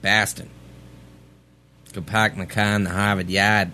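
dropped r's, Bahston, pahk the cah; broad Boston, no r's; Boston accent. Pretend. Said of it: "Boston accent".

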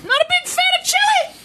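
mock outrage. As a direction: act scandalized.

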